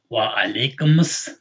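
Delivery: Wa alaikumus with the m linked into us, slow; linked vowel. Shown as a demonstration. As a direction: join "Wa alaikumus" smoothly, vowel carried over. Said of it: "that vowel goes over to the next word".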